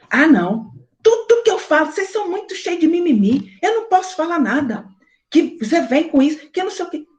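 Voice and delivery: mocking husband. Mocks husband's complaint.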